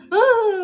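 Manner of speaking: high, loud imitated wail; mock crying wail. Imitating her friend's crying, wailing loudly. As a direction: burst out in a pretend bawl. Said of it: "ganun siya".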